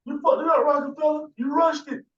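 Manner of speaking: high-pitched mocking rebuke; mock scolding. Acting out a rebuke to Rockefeller, high mock scolding.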